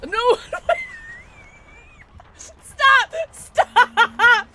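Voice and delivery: high, loud shrieks breaking into laughter; laughing screams. Real, not acted. Player pleads with the attacker, laughing screams. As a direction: scream for it to stop while laughing.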